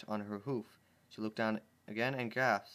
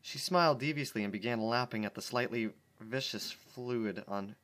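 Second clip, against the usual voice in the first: ordinary talk, nothing marked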